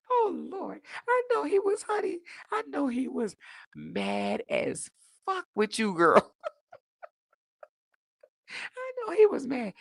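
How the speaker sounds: high, giggly I know he was mad; gleeful cackling gossip; laughing tease. Real, not acted. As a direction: tease her through laughter. Cackling teasing to viewer.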